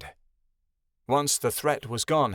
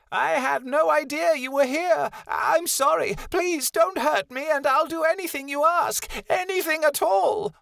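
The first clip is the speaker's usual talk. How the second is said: terrified pleading. A narrator's reading. Malachi begging the Great One in terror. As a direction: beg for your life in a panic. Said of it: "cried Malachi in fear".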